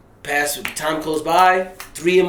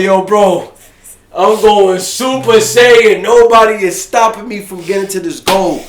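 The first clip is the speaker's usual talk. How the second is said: loud, pumped-up boast, much louder and higher; hyped up; 5 semitones higher, 11 dB louder, slower than usual. Retold or imitated. Fan at the concert telling his boy, fired-up shout. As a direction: roar it like you're powering up.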